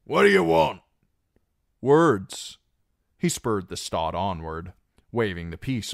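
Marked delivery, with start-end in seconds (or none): 0.0-0.8 loud, high hail from the walls; shouted challenge. Acted. Guard on the walls challenges the rider, loud. 1.8-2.6 loud, high single word shouted up; called reply. Acted. Reek answers the guards from below, calling loudly.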